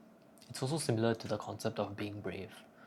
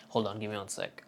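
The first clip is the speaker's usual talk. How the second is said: usual speaking voice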